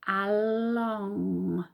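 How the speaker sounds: ALONG in one long smooth glide; said smoothly. Shown as a demonstration. Teacher helping children blend a word, ALONG stretched smoothly. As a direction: blend ALONG slowly with no breaks. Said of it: "Let's say it smoothly".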